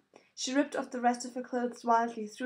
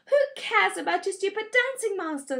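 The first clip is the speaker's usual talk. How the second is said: higher, louder scornful snap; flared; 9 semitones higher, 7 dB louder than usual. A narrator's reading. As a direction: flare up at your sister. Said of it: "Sansa flared".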